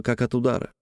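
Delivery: ordinary delivery, nothing marked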